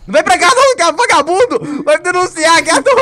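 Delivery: high, loud yelling while fleeing; being chased. Real, not acted. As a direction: scream at the chaser, half laughing. Seeker chasing, yelling at it.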